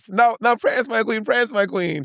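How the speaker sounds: high playful host call; host's call. Retold or imitated. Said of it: "Oh, oh, okay".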